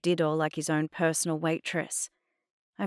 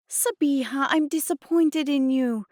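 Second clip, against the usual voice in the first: high, raised reprimand; disappointed scolding; 9 semitones higher, 5 dB louder than usual. A narrator's reading. Mum to misbehaving daughter, disappointed scolding. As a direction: tell her off in dismay.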